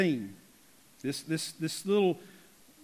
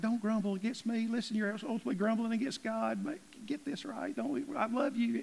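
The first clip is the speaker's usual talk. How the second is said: higher, softened, strained plea; pleading Moses; 5 semitones higher, 6 dB quieter than usual. Acted. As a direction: plead with them in a small high voice. Voices Moses pleading with grumblers.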